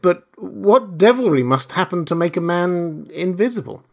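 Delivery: high, aghast disbelief; appalled question. A narrator's reading. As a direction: ask it in horrified wonder. Kemp is appalled.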